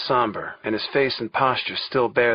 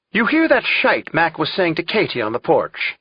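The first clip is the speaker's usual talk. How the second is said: animated, higher-pitched Irish brogue; 5 semitones higher, 4 dB louder than usual. Acted. Tom imitates old Mac for Clarence, Irish brogue. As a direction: put on your best Irish brogue. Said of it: "in my best Irish brogue".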